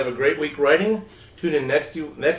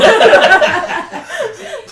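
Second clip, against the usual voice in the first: high burst of real laughter; big laugh; 7 dB louder than usual. Real, not acted. Host laughing at the erotica joke, loud burst. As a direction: let a loud laugh break out.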